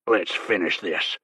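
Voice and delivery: low, slow menacing snarl. A narrator's reading. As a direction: snarl it as a challenge. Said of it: "he snarled".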